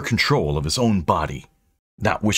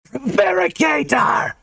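high, drawn-out ghostly scream; slow shrieked accusation; 14 semitones higher than usual. A narrator's reading. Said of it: "She pointed and screamed".

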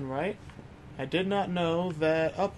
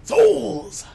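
dramatic exclamation; 5 semitones higher, 7 dB louder than usual. Pretend. Dramatic repeat.

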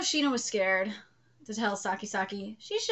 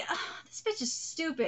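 normal talking voice

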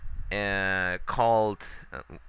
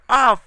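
sudden loud high "oh" of annoyance; frustrated yelp; 12 semitones higher, 13 dB louder than usual. Real, not acted. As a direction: burst out at the failure. Frustrated gamer yelling at game.

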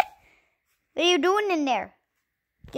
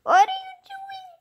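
high pet talk. Real, not acted. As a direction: squeak it at the dog. Squealing at pet dog.

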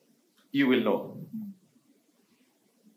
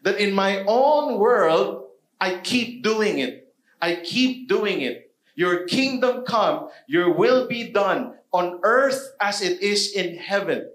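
higher, louder and slow, rising to prayer; full-force preaching; 6 semitones higher, 4 dB louder, slower than usual. Acted. Pastor urging the church to pray daily, slow impassioned preaching. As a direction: preach it slowly, with full conviction.

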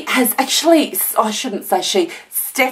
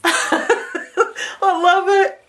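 laughing; 10 semitones higher, slower than usual. Real, not acted. Laughing at magnets.

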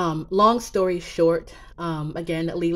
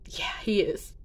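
tearful whisper; 6 dB quieter than usual. Real, not acted. Grieving cousin, holding back tears.

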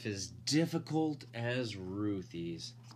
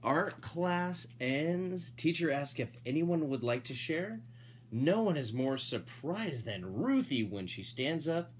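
usual speaking voice

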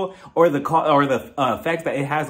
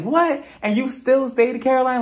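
much higher, slow, incredulous; can't believe she stayed; mock-shocked, higher and slower; shocked disbelief; 8 semitones higher than usual. Reacting to dad's payoff.

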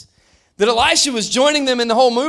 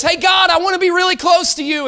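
eager call; 8 semitones higher, 4 dB louder than usual. Retold or imitated. Eager believer calling to God.

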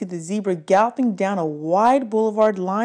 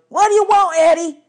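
much higher, louder trucker yell; 12 semitones higher, 7 dB louder than usual. A narrator's reading. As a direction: shout through the trailer wall. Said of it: "The trucker inside with us yelled".

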